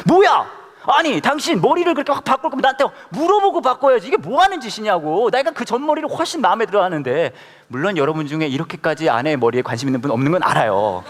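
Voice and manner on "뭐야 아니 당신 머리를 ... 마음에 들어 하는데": high, indignant husband's outburst, acted; angry husband. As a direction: act out a husband scolding his wife, pitched up. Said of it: "남편분이 바로 화를 냅니다". (화내는 남편 흉내)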